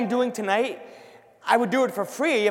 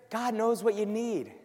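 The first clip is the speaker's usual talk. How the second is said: ordinary delivery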